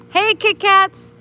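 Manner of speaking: high, loud, slow sing-song call to a cat; high cooing call to a kitty; cutesy calling voice. Shown as a demonstration. Calling the cat by new name.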